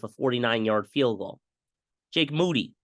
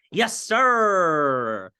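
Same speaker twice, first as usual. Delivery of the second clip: 1 excited cheer; 5 semitones higher, 4 dB louder than usual. Real, not acted. Celebrating the touchdown.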